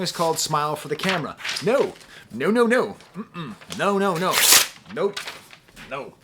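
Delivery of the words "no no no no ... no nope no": loud, high string of refusals; dramatic rejection; emphatic no. Real, not acted. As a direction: shut the song down hard.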